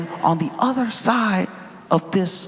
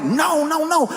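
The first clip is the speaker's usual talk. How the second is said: put-on excited kids; 10 semitones higher than usual. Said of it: "And we're like".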